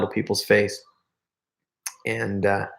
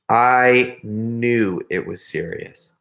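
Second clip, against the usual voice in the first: slow, drawn-out "I knew it was serious"; grave emphasis; much slower than usual. Retold or imitated. Storyteller dwelling on a friend's grave news, slow emphasis. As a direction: stretch "I knew" out slowly and gravely. Gravely repeats the point for effect.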